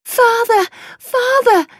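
very high, loud calls; shouting Father. Acted. A lost girl spots her father and calls out, loud and high. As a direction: cry out for your father from a distance. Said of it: "she shouted out".

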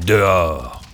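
put-on the mummy ordering the children out; mummy's command. A narrator's reading. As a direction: growl out the first warning. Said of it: "dit-elle".

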